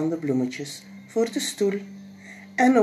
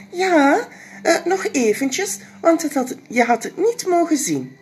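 put-on much higher hesitant little boy; flustered boy; 12 semitones higher, 5 dB louder than usual. Jip flustered keeping the surprise from parents. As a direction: stumble over the secret.

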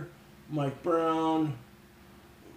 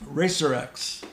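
ordinary delivery

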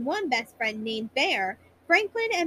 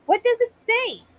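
higher, louder excited question; eager question; 9 semitones higher, 5 dB louder than usual. Acted. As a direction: ask it breathless with eagerness. Franklin eager.

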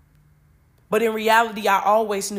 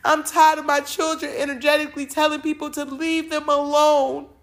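fed-up God, voiced a little higher; exasperated divine complaint; God at breaking point; 4 semitones higher than usual. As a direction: speak as a weary parent who has had enough. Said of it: "got to his breaking point".

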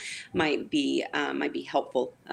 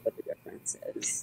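fading out; 10 dB quieter than usual. Real, not acted. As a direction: voice dropping away to a faint whisper. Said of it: "Your voice is fading out".

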